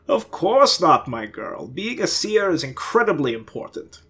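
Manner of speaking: hammed-up stagey declaration; theatrical. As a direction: play it like a stage ham. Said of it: "with pure theatrical fashion".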